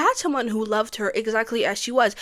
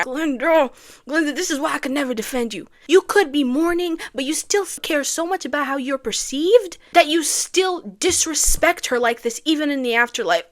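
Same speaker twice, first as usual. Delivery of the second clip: loud, raised-voice reproach; exasperated scolding; 4 semitones higher, 5 dB louder than usual. Acted. Fan telling off Glinda for the fire, exasperated. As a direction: scold her, pushing harder as it goes.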